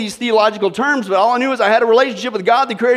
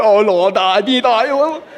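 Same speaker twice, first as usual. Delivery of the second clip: mock fumbling prayer; 3 dB louder, slower than usual. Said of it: "they start doing it too".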